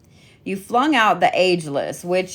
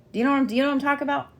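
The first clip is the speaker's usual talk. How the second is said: rushed aside; 7 semitones higher, much faster than usual. Real, not acted. Rapid aside to viewers.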